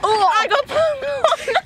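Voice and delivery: high, slow relieved cry; relieved delight. Real, not acted. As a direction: gush with happy relief. Relief at getting popcorn.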